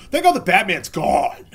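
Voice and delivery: loud, drawn-out celebration; mock relief. Facebook crowd cheering his ban, loud mock relief. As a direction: exaggerate it, slow and loud.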